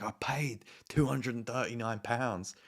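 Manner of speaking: slow, deliberate, stating the facts; slow firm statement to the intercom lady; slow insistence. Retold or imitated. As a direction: spell out the amount slowly.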